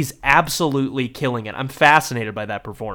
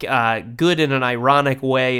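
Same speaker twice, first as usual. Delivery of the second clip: ordinary delivery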